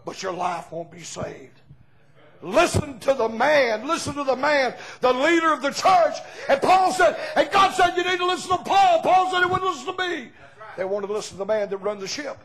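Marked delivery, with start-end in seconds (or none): 2.4-10.3 high, loud shouting preacher; full-force revival preaching. Acted. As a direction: whip the congregation up, calling out. Preacher shouting the message home.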